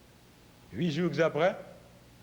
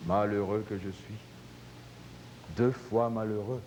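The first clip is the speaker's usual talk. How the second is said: low, despondent lament; moaning; 8 semitones lower, 3 dB quieter than usual. A narrator's reading. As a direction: lament your lot, low and halting. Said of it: "l'homme se retira en gémissant". (gémissement abattu, d'une voix basse)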